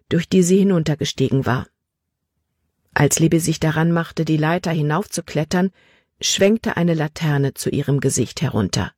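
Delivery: ordinary delivery, nothing marked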